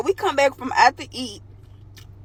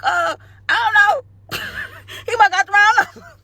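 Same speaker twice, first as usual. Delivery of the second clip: panicked; 8 semitones higher than usual. Retold or imitated. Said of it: "So I panic".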